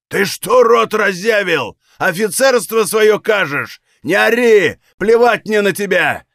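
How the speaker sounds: high, drawn-out furious shout; angry shouting. Acted. Валет answering the officer's taunts with a defiant shout. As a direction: shout back in outraged defiance. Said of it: "выкрикнул валет".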